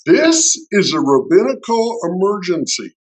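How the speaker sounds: lightly voiced, emphatic declaration; rabbi's wife. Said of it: "Cindy goes".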